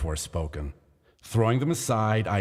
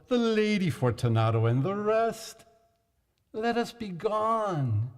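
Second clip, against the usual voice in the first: sad voice; 11 semitones higher than usual. A narrator's reading. Chained Fortunato to Montresor, high sad false cheer. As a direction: pretend it's all a joke, sadly. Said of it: "a sad voice".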